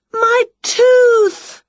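high loud delighted cry; overjoyed at finding it. A narrator's reading. Winnie seeing her tooth, high delighted cry. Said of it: "said Winnie".

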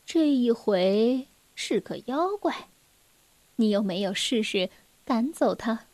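soft, low-voiced question; sighing mother. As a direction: gently ask after a sigh. Sighing mother questions child gently. (妈妈叹息后轻声询问)

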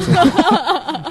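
slow high laughing drawl. Real, not acted. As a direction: stretch it out while laughing. Laughing drawn-out repeat.

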